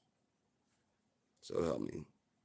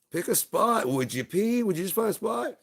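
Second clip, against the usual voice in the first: much higher, louder exasperated nag; impatient nagging; 11 dB louder than usual. Acted. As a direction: hurry up already, shrieked. Impatient voice waiting for pee.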